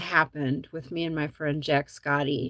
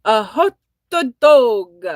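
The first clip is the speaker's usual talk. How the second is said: hammed-up Italian accent, slow and much louder; mock Italian accent; 6 semitones higher, 12 dB louder, much slower than usual. Telling the hot dog joke's punchline, in a mock Italian accent. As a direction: stretch it out like a cartoon Italian waiter. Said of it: "How do you say hot dog in Italian?".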